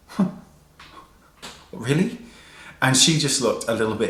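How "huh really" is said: high, quiet disbelieving huh; stunned, can't believe it; mock stunned disbelief; incredulous. Stunned reaction retold.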